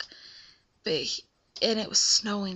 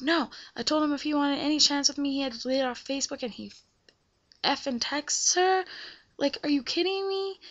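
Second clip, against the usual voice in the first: rising, fast, outraged retelling; higher, rushed indignant rant; retold angry rant; 5 semitones higher than usual. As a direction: vent it to mom, climbing higher. Ranting to mom about boyfriend.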